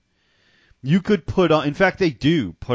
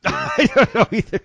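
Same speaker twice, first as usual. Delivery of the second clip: laughing; 10 semitones higher, 5 dB louder than usual. Real, not acted. Mocking repeat.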